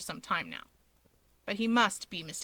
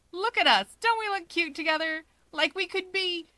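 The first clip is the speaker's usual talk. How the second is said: higher and bright, gushing; grinning delight; 8 semitones higher, 3 dB louder than usual. Acted. Natsuo to everyone, gushing with a grin. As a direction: show off the group happily. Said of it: "Natsuo grins".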